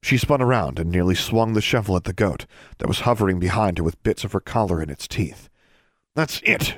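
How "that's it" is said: high, exasperated snarl. A narrator's reading. Student to the goats, an angry snarl. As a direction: snap it, fed up. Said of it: "she snarled".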